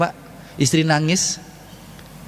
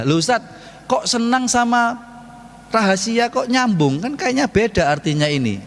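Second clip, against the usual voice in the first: mock puzzled listener; 8 semitones higher than usual. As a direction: sound baffled and questioning.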